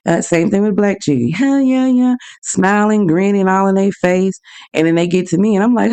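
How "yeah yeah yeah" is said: fawning over the customer ahead; mock cheery. The cashier chatting up the customers ahead, mock cheery.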